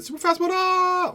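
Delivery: sudden squeaky high-pitched yell; catchphrase shout. Retold or imitated. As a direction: fling it out way up high like a hype catchphrase. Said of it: "like that".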